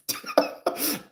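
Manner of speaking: high burst of laughter. Real, not acted. Lawyer cracking up at his client, high laugh. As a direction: cackle high. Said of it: "I start laughing again".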